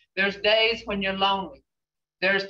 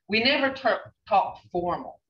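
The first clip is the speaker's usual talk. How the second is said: usual speaking voice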